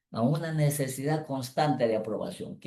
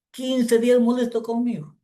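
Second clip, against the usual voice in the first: pleading confession; 11 semitones higher, 6 dB louder than usual. Retold or imitated. Young man confesses.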